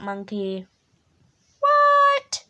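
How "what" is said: high loud shocked what. Pretend. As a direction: yelp it out in disbelief. Shocked at the book's words.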